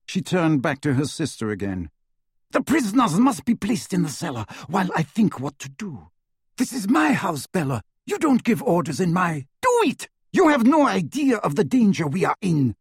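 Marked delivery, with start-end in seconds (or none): 2.5-9.5 higher, firm, offended; indignant sister. A narrator's reading. Narcissa defies her sister. 9.6-12.7 much higher, loud shriek. A narrator's reading. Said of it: "shrieked Bellatrix".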